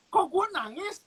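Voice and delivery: high, bewildered self-questioning; can't believe he's crying; shocked disbelief. Retold or imitated. Tough street kid shocked at his own tears, high and bewildered. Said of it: "Karena kakak shock".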